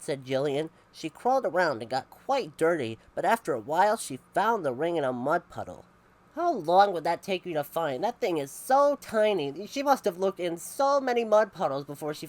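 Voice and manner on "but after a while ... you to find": usual speaking voice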